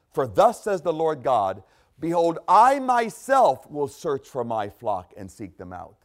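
solemn, measured scripture reading. Acted. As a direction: read God's words with weight. Reads scripture in sermon.

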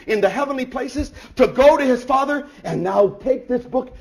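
high, loud, driving proclamation; full-force preaching. Real, not acted. Preacher proclaiming Christ's offering, full force.

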